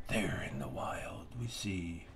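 a soft Attenborough hush over a wild scene; David Attenborough. Retold or imitated. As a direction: whisper it like a wildlife documentary narrator. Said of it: "I love his voice".

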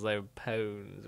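normal talking voice

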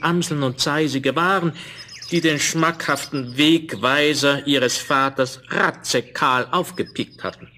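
ordinary delivery

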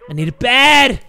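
loud, urgent shout; calling out. Acted. Father calls after the man with the doll, loud. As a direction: call after him urgently.

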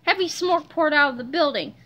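plain reading voice

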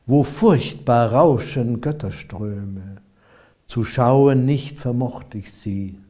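measured verse reading, lines set apart by pauses; declaimed dream song with a falling refrain; solemn epic poem recitation. Acted. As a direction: recite an old ballad, verses swelling, refrain easing down.

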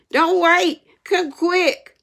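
high, loud panicked urging; urgent plea. A narrator's reading. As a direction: urge someone to hurry. Sid urges aunt to hurry.

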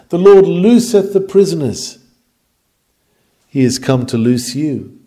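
usual speaking voice

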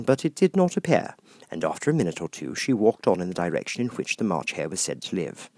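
ordinary delivery, nothing marked

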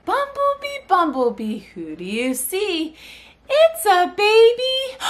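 high sing-song, last word left hanging; animated story reading. Shown as a demonstration. Mother drops a word so babies finish it, animated sing-song. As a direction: swoop up and stretch it for the baby to finish. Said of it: "I just get real animated".

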